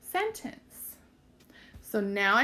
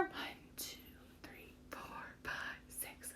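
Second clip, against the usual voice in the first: whispered check; 21 dB quieter than usual. Shown as a demonstration. Teacher whispers while counting the words.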